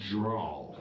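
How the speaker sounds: low mock showdown call; mock Western duel call; gunslinger challenge.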